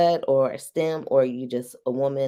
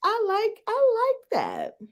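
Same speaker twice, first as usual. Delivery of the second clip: mock purr. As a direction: stretch it out up high like a tease. Acting out bedroom talk.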